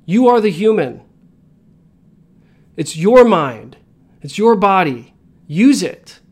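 emphatic rally. Real, not acted. Motivational climax to audience.

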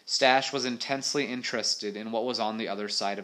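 animated adventure read-aloud; story narration. A narrator's reading. Reader telling of a monster fight, story narration.

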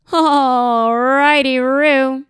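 loud, high, drawn-out singsong; goofy catchphrase. Acted. Host quotes Ross for quiz question eighteen, goofy loud singsong. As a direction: belt it out as a drawn-out dorky catchphrase.

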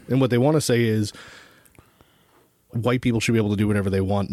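normal talking voice

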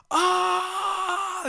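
long, high-pitched "Ah!" scream; mock scream. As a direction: reenact screaming your lungs out, very high. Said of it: "screaming at the top of my lungs".

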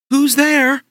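a giant woman calling out; woman's voice. Acted. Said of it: "said a woman's voice".

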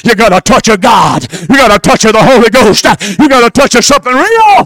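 shouted, high sermon climax; full-force preaching. Acted. Preacher shouting blessings over the congregation at full force. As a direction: preach it hard and high to the crowd.

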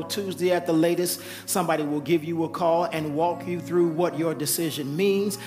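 normal talking voice

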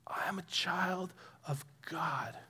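slow, whispery affirmation; hushed declaration. Shown as a demonstration. Preacher declares identity to the congregation, slow and hushed. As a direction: breathe it out softly, word by word.

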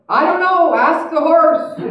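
high, loud rider's shout; comic punchline call; calling back. A narrator's reading. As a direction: holler it helplessly from a galloping horse. Rider calls back, koan punchline.

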